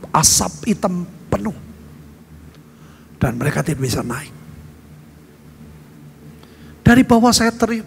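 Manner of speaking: ordinary delivery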